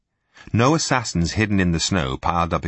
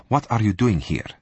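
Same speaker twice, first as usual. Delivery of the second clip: ordinary delivery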